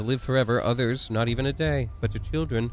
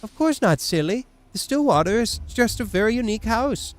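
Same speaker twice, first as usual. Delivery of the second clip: voiced character; 8 semitones higher than usual. Voicing game character dialogue.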